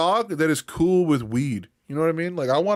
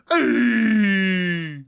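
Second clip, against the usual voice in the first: excited hey; 6 semitones higher, 4 dB louder than usual. Real, not acted. Greeting the dog on screen.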